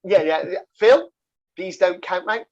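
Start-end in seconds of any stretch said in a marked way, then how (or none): 0.8-1.1 calling out. Real, not acted. Calls out to his co-host.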